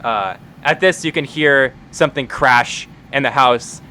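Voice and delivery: ordinary talk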